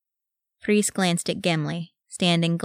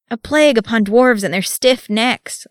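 higher, louder exasperated outburst; frustrated cry; 6 semitones higher, 6 dB louder than usual. A narrator's reading. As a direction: throw up your hands and cry out. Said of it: "Legolas cried in frustration".

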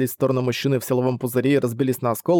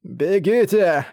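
slow, hoarse dying gasp; hoarse rasp; 5 semitones higher, much slower than usual. Acted. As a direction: rasp it out with no breath left. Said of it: "прохрипел Кейп".

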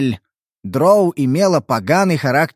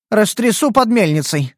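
put-on cheeky kitsune girl; 6 semitones higher than usual. Kitsune voiced cheerfully.

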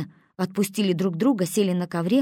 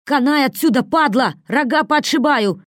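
loud, higher enraged threat; furious threat; 7 semitones higher, 8 dB louder than usual. A narrator's reading. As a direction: explode at him. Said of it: "вскочил, не выдержав". (взбешённо, громко и высоко)

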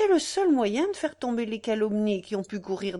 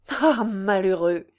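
plain reading voice

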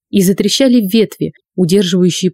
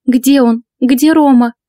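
frantic mother; 5 semitones higher, slower than usual. Acted. Maria's voice, frantic.

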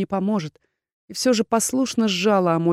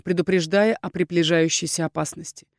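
plain reading voice

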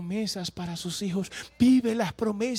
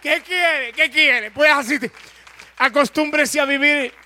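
much louder, hammering each question; shouted preaching; 5 semitones higher, 12 dB louder than usual. Acted. Preacher shouting at the congregation to accept God's way, full force. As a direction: thunder it at the pews.

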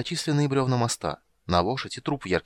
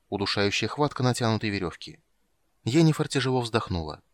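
ordinary delivery, nothing marked

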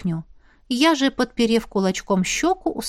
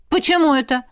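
higher, displeased question; frowning; 7 semitones higher than usual. A narrator's reading. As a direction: demand a reason with a frown. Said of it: "нахмурилась я".

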